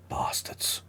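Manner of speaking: breathy whispered bastards under the breath; whispered curse. Acted. The dwarf lord whispers a curse at traitors. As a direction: hiss it softly with contempt.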